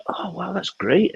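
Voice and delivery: high, slow imitated wow; drawn-out high mock amazement; impressed. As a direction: coo it slowly, imitating someone impressed. Acting out the impressed helper.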